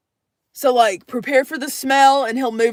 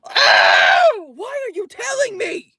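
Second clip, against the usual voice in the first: mock panic; 10 semitones higher, slower than usual. Real, not acted. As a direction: wail it like you're doomed. Panicked reaction to stolen car.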